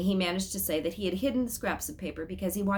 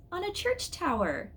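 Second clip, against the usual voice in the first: high Mama voice; 11 semitones higher than usual. A narrator's reading. Mama peering at the calendar, high and wondering.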